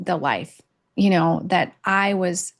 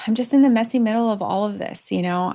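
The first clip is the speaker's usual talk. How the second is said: ordinary talk, nothing marked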